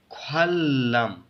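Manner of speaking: ख्वालम slowly, with one held L; blended L sound. Shown as a demonstration. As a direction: join ख्वालम on a long ल. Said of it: "एक ही लाम के साथ". (ख्वालम, एक लंबे लाम से, धीरे)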